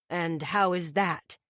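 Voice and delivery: low, slow father; a hint of a guarded father. Father asks slowly.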